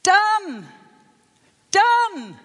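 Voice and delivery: high, loud triumphant shout; joyful proclamation. Retold or imitated. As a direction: declare it finished with full force. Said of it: "And he said".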